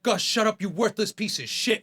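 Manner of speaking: chef tearing into a cook; chef yelling abuse. Retold or imitated. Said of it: "he's like".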